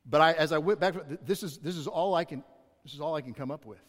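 ordinary delivery

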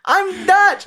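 Goldmember voice. Retold or imitated. Goldmember rolling out on skates, high loud camp greeting. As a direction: greet the crowd like a bizarre showman. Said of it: "And he's like".

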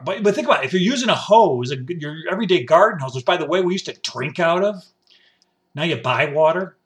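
ordinary talk, nothing marked

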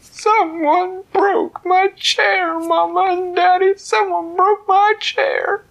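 very high, loud, slow wail; a cub sobbing to his parents; high blubbering cub voice; crying baby bear. Acted. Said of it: "Baby Bear said".

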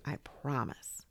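slow, soft whisper; whispered little assurance; hushed promise. Acted. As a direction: promise it under the breath. Toy's sleepy bedtime promise.

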